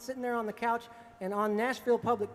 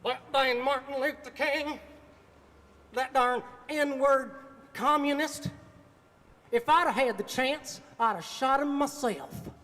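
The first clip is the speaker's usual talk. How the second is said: slow, higher and louder hateful outburst, lightly voiced; blurted bigoted rant; 4 semitones higher, 4 dB louder, slower than usual. The bandmate suddenly blurting hate at the TV, slow and loud. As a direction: spit it out slow like an old bigot. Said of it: "he blurts out of his mouth".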